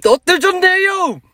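sudden shrill high shout. Shown as a demonstration. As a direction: yell it in a squeaky high register. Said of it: "初めてちょっと高い声を出してみて".

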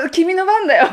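high, loud teasing prompt; the group nudging her to play next, high and loud; playful urging. Retold or imitated. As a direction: egg her on to take the guitar.

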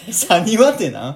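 loud, baffled question; incredulous. Real, not acted. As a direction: demand what that even is. Baffled by unknown word.

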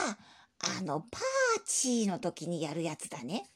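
put-on low, slow old grandpa voice; old man voice. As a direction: speak like a doddering old grandpa. Grandpa voice held. (低くゆっくりしたおじいさんの声)

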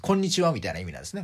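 usual speaking voice

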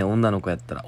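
usual speaking voice

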